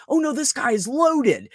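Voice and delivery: imitating a coworker's slow emphatic reply; quoted disbelief. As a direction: stretch it out, he's rich. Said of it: "I'm like".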